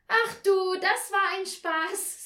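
high, loud, gleeful exclamation; delighted child. A narrator's reading. Anja in bed after the game, high happy exclamation.

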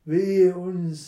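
high, loud cry of alarm; frightened cry. A narrator's reading.

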